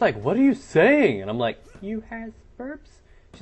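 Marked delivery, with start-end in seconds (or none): 0.2-1.2 louder, higher, drawn-out imitation of a confused girl; mock her bewilderment, slowly; baffled. Girl answering a troll in the lobby, baffled. 1.8-3.0 put-on slow, high, soft little-kid squeak; childish troll voice. Troll repeating himself to the confused girl, childish. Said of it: "I put on this little childish voice".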